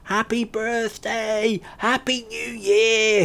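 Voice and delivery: high, slow, stretched-out shout from the top of the stone; giddy shout. Acted. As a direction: bellow it like a fool on a monument. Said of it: "he shouted".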